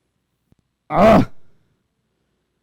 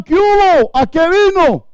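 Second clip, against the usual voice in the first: high, loud jeering shout; mock yelling; 9 semitones higher, 5 dB louder than usual. The crowd yelling at the boy to jump, mocking shout. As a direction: yell it at him from the riverbank. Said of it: "todos le gritaban".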